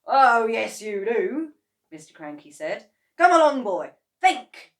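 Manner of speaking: loud insistent demand; pressing. A narrator's reading. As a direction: push him to remember. Mr Kranky pressing George.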